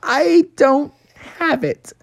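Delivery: nervous delivery guy owning up; sheepish admission. Acted. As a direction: admit it timidly, dragging it out. Bubby admits the pizza is missing.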